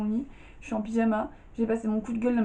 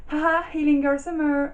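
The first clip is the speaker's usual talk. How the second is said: ton moqueur un peu plus aigu; moqueuse; 5 semitones higher, 5 dB louder than usual. Retold or imitated. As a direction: se moquer de son propre projet. Mocking her own series idea. (parodie ironique d'un slogan)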